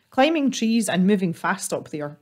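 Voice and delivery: ordinary delivery, nothing marked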